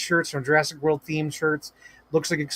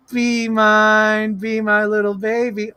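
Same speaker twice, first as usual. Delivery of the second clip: 1 cooing; 5 semitones higher, 4 dB louder, slower than usual. Pretend. Cooing to the toy.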